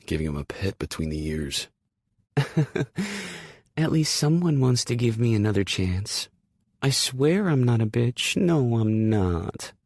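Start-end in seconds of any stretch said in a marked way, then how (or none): 2.4-3.5 high light giggle; a quick girlish giggle. Acted. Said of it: "She giggles". 3.8-9.7 a hint of sweet dog talk, higher; sugary dog talk. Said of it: "she croons in that sugary sweet talking to a dog voice".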